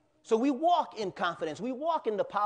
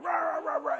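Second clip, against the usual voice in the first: dog bark imitation; 9 semitones higher than usual. Dog Spike attacking Tom.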